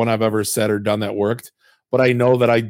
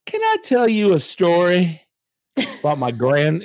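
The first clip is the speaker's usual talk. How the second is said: slow and drawn out, pitched higher; really slow; 7 semitones higher, slower than usual. Retold or imitated. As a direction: lean in and take your time, like starting a fable. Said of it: "he's saying it really slow".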